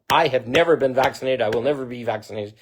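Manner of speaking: ordinary talk, nothing marked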